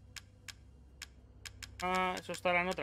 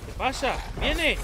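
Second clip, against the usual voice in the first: much higher, louder panic; alarmed cry; 8 semitones higher, 8 dB louder, slower than usual. Real, not acted. Player sees the monster charging, alarmed high cry. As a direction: shriek that it is coming.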